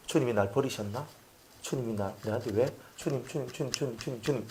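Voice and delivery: small forsaken voice; hushed bewilderment. Acted. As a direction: call for God faintly, feeling abandoned. Acts believer feeling abandoned.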